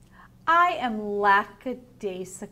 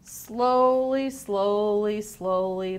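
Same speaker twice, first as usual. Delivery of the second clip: drawn-out story refrain; 5 dB louder, much slower than usual. A narrator's reading. Teacher reading the sloth book to children, stepping down the refrain.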